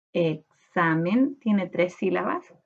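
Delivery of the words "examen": "examen" slowly split, e-xa-men; syllable by syllable. Shown as a demonstration. Teacher counting syllables, "examen" slowly piece by piece. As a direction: sound out "examen" in separate syllables. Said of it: "cuando separamos por sílaba".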